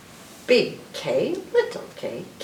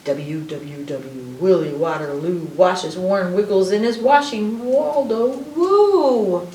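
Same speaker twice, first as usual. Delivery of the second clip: put-on reading to children; 5 dB louder than usual. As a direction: build it up and let the last word soar. Playful reading of alphabet rhyme.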